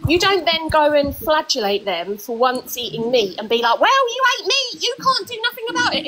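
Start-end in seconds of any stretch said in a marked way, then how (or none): 3.8-6.1 high-pitched mock indignation; mocking critic. Mimicking someone berating a vegetarian for once eating meat, mock indignant. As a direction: mimic an accuser in a much higher voice.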